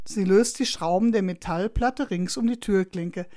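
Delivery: plain reading voice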